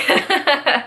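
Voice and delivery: short amused laugh; light laugh. Real, not acted. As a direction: laugh at your own joke. Laughing after the song.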